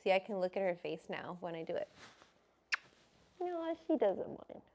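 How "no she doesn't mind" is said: high dog-talk. Real, not acted. Answering for the dog.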